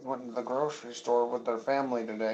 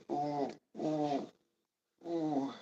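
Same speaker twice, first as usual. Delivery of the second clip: ordinary delivery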